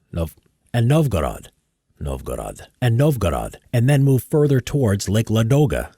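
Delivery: measured history narrator read; documentary narration. Acted. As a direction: read it like a war documentary voice-over.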